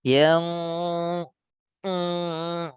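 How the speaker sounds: nose pinched open and shut; pinching nose. Shown as a demonstration. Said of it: "buka tutup coba".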